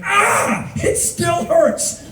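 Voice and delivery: high, slow anguished whine; pained whine. Retold or imitated. Ex-lover remembering heartbreak, high and slow. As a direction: wail it like an old wound.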